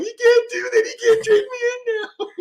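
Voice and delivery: hammed-up crybaby plea, voice squeezed high; mock crying. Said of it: "I'm like, crying".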